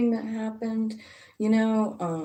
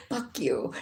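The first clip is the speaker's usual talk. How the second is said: a low, muttered-down retold curse; understated retold anger; mild mock anger; 4 semitones lower than usual. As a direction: drop it off low like a grudge. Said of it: "he was mad".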